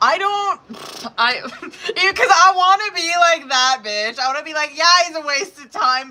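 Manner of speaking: high, loud, laughing outburst; laughing confession. Real, not acted. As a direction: blurt it out through real laughter. Cracks up admitting her bitterness.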